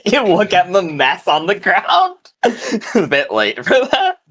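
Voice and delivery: high-pitched, amused through real laughter; laughing. Real, not acted. Game master teasing players over the killing, laughing. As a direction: say it while cracking up.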